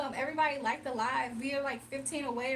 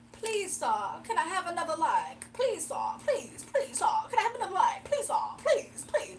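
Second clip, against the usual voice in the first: higher, rising hammed-up pleading; mock begging; 4 semitones higher, 4 dB louder than usual. Mocking the viewer who thinks she begs for likes. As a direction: beg like an orphan, climbing higher. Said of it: "He thinks I'm begging".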